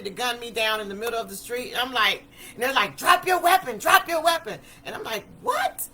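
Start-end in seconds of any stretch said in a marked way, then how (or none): 3.0-4.6 loud, high barked police order; acting out an urgent armed command; police shout. Police order at gunpoint, retold. 5.4-5.9 very high-pitched, baffled squeak; acted-out bewilderment, pitch shooting up; confused yelp. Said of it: "I'm so confused".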